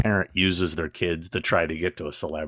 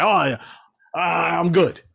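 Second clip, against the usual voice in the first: rude fan voice; 10 semitones higher, 4 dB louder, faster than usual. Retold or imitated. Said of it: "And he's like".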